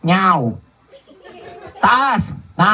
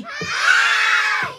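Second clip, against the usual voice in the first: 1 shouted hi; 4 dB quieter than usual. Pretend. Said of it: "Sabihin nyo, "Hi!".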